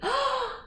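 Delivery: sharp high storyteller's gasp; shocked gasp. Acted. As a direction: gasp in surprise at the sight. Peddler looks up into the tree.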